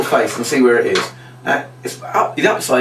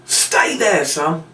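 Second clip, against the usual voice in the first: mock shout; 7 semitones higher, much slower than usual. Shown as a demonstration. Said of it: "Everybody shout".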